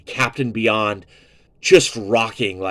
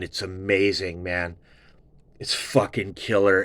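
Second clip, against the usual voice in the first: usual speaking voice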